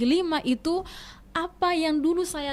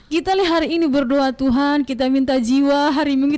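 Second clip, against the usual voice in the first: retold excited reply; 3 semitones higher, 6 dB louder than usual. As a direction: act out an amazed friend, voice raised. Acting out friend's amazed, excited reply. (menirukan teman yang heboh sambil tertawa)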